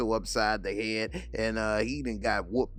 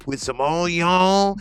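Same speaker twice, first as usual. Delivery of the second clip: higher, louder and slow on onion; drawn out like a man who can taste it; hammed-up craving; 9 semitones higher, 11 dB louder, slower than usual. Bumpy drawls out his dinner order with relish.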